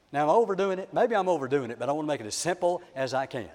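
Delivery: normal talking voice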